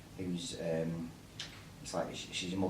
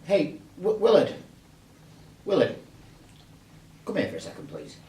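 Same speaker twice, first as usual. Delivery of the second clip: bad acting; 9 semitones higher, 8 dB louder than usual. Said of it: "Don't worry, excuse mine".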